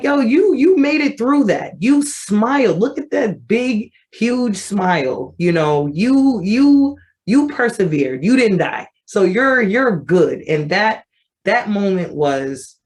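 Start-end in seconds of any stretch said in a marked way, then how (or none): 0.0-10.4 high, hyped-up pep talk to her younger self; proud cheering. Real, not acted. Adult self praising old photo.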